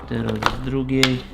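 normal talking voice

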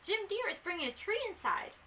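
high-pitched puppy voice; excited puppies. A narrator's reading. Puppies tell their mother of a tree inside, in high excited voices. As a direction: blurt out the news as a puzzled little pup.

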